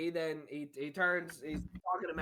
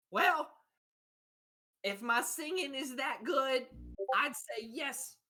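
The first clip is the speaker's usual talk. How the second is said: character voice; 6 semitones higher, slower than usual. Acted. GM voices mystery singer character.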